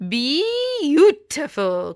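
high, loud, drawn-out cry of delight; queen exclaiming in delight. Acted. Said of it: "cried Queen Priscilla Bazoo".

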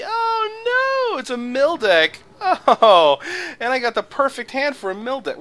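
high squeaky mock alarm; mock panic. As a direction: shriek it in hammed-up alarm. Mock panic over a mill deck.

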